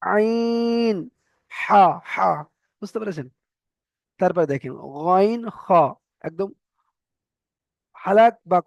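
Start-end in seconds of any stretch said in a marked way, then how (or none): 0.0-2.3 আইন and হা from mid-throat, higher and held; throat letter demo. Shown as a demonstration. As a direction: model the throat letters one by one. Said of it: "এই মাঝখান থেকে উচ্চারণ হবে". (গলার মাঝখান থেকে বর্ণ উচ্চারণ)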